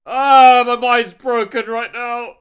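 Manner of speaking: loud distraught crying; sobbing out loud, can't stop; crying, worn out. Real, not acted. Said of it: "I can't stop crying".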